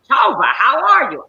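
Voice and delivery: loud, high-pitched British greeting; mother's put-on British accent. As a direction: call out grandly in a British accent. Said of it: "where'd you get that accent".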